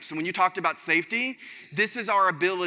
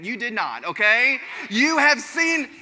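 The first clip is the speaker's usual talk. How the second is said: ordinary talk, nothing marked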